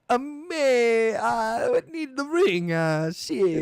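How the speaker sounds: high, slow, strained orc voice; dim orc. Pretend. Orc rambling to his master in a put-on voice. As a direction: play a dumb orc begging for the ring. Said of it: "he's like".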